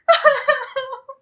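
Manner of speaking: high, sharp scoffing laugh; a short burst of bitter laughter; scornful laugh. Real, not acted. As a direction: laugh in his face, mocking. Wife scoffs during quarrel.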